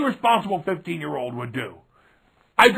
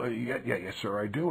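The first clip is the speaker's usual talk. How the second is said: put-on meek kid; 10 semitones lower, 11 dB quieter than usual. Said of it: "meek kid answering wrestler".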